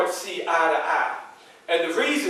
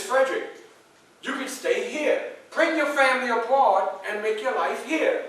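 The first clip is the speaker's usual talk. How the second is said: lady's invitation; 6 semitones higher than usual. Retold or imitated. The lady invites him to stay.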